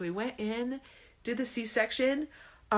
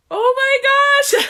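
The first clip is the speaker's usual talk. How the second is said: overwhelmed awe; 15 dB louder, slower than usual. Retold or imitated. Overwhelmed by newborn.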